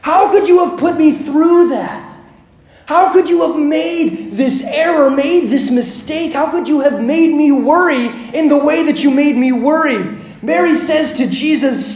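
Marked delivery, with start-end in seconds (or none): 0.0-10.0 high, emphatic parental reproach; acted outburst from a frightened parent; anger born of fear. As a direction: hammer each question at the child. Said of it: "fear and trepidation turned to anger".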